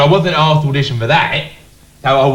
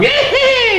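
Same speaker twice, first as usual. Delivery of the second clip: high squeaky cackle. Retold or imitated. Mimicking the little man beside Jabba with a high cackle. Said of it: "He'd go".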